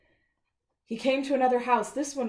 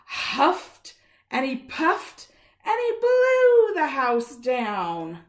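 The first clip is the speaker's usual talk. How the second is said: louder, drawn-out huff and puff; dramatic huffing; 4 semitones higher, 9 dB louder, much slower than usual. A narrator's reading. Reading the wolf's big blow to a child, slow and loud. Wolf blows house down.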